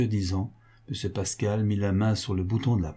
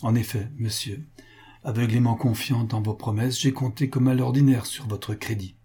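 ordinary delivery

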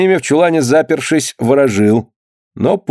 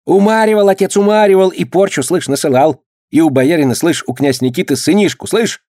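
rapid chatter; 5 semitones higher than usual. Acted. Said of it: "затараторила, точно горох высыпала".

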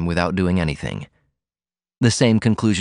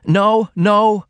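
high, loud cry of protest; cried out; 13 semitones higher, 5 dB louder than usual. A narrator's reading. Daughter refusing to be released, crying out. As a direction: cry out in alarm. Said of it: "Ruth cried".

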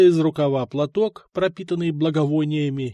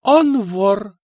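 жёстко; 6 semitones higher, 6 dB louder than usual. Acted. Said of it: "жёстко ответила Мария".

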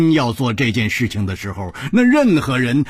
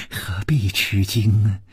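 crazed sweetness; 4 semitones lower, 4 dB quieter than usual. A narrator's reading. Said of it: "只听宫南燕痴痴地说".

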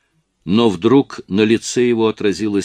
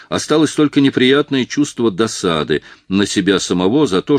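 plain reading voice

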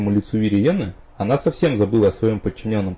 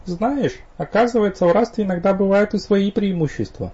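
higher, playful female voice; young woman voice; 11 semitones higher than usual. A narrator's reading. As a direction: tease him lightly. Viriena's voice.

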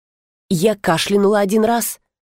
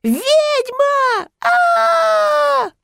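piercing high shriek into a long scream; terrified screaming; much slower than usual. A narrator's reading. Brunette fleeing what she takes for a witch, screaming. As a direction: scream the alarm at a very high pitch. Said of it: "Продолжая кричать".

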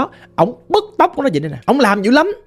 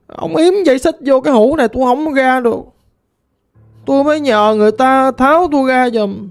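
lightly voiced ghost girl, plaintive and mournful; a hint of a lost spirit lamenting; 2 semitones higher than usual.

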